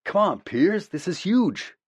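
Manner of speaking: bursting with excitement, pleading; excited. A narrator's reading. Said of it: "practically vibrating with excitement".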